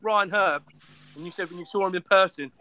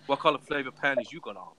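lower, lightly sexy voice; imitating a flirty come-on; 7 semitones lower, 4 dB quieter than usual. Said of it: "you used to do a sexy voice".

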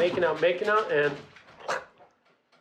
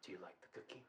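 hushed, low creepy whisper; dark creepy date; 9 semitones lower, 29 dB quieter, faster than usual. Retold or imitated. Storyteller imitating her date turned dark, creepy whisper. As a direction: imitate a sinister lover, barely audible. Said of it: "He turned dark all of a sudden".

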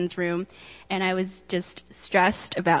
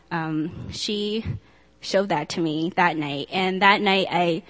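ordinary delivery

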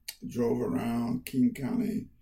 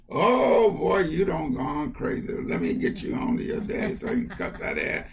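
loud, exasperated mother; mother exclaiming loudly to her son, exasperated; mother's voice; 3 semitones higher, 7 dB louder than usual. Retold or imitated. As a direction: burst out like a mother at her wits' end. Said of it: "And she said".

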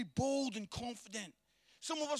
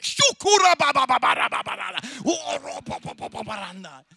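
very loud, rapid-fire syllable babble; explosive mock tongues at full volume; imitating speaking in tongues; 14 dB louder than usual. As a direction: burst into hammed-up fervent tongues. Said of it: "Speaking in tongues".

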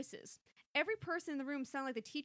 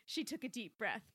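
ordinary delivery

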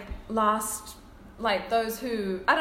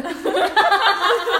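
normal talking voice

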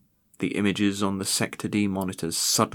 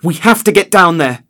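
high, loud panicked cry; urgent, panic cracking the voice; voice breaking with panic; 9 semitones higher, 13 dB louder than usual. Acted. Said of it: "voice breaking with panic".

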